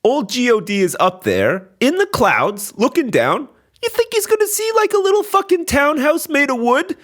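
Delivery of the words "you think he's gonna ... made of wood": squeaky high mocking voice. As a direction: pitch it way up, scornful. Mocking God's view of church.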